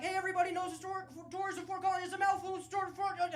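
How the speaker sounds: high, loud tour-guide patter. Acted. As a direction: call out to a walking tour group. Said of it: "walking around being like".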